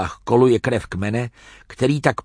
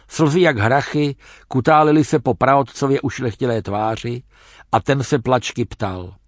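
ordinary delivery

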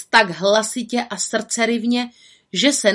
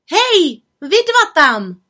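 loud, higher call up the tree; 9 semitones higher, 6 dB louder, slower than usual. Acted. Little witch calling to the boys in the tree. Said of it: "volala malá čarodějnice".

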